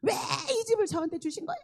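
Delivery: high, loud re-enacted protest; angry outburst. Blaming God for giving her the house, angry cry. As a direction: rail at God in a heated wail. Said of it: "막 이러면서 화를 냈더니".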